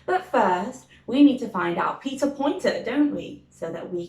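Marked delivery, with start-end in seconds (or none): none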